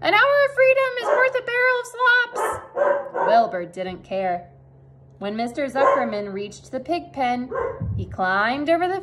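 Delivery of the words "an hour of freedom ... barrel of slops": very high goose voice; goose honking. A narrator's reading. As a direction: honk a warning, pitched way up. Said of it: "honked the goose".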